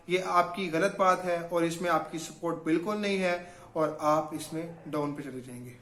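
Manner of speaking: soft, quiet affirmation for listeners to repeat; guided affirmation. Shown as a demonstration. Coach guiding viewers through a repeat-after-me affirmation, soft and quiet. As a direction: lead them gently through the pledge, eyes closed. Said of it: "close your eyes and repeat after me".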